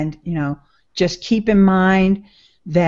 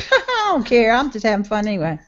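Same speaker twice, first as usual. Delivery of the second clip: carefree laughing; 4 semitones higher, faster than usual. Retold or imitated. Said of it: "you're like".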